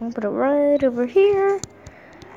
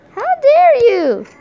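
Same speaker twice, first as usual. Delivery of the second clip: higher, louder hammed-up how dare you; mock outrage; 10 semitones higher, 5 dB louder, slower than usual. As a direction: shout it like a cartoon villain scolding.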